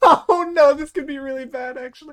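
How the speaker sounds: high, squealing laugh through the words; laughing dismay. Real, not acted. Game master seeing a terrible dice roll, laughing high in dismay. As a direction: burst out laughing, loud then fading.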